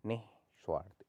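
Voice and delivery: निस्वार्थ split as nih, svarth; breathy pause after नि in निस्वार्थ; sounded visarga. Shown as a demonstration. Teacher models it again, निस्वार्थ with visarga. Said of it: "इस तरीके से".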